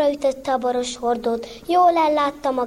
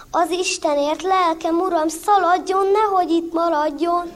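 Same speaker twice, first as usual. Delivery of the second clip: higher, louder urgent pleading; mock alarm; 6 semitones higher, 4 dB louder than usual. Zsuzsi pretends alarm, urging guest to run. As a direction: fake panic to scare the guest off.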